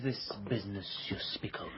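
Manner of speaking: hushed unvoiced whisper. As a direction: whisper it like a secret deal. Whispered mock-secret question.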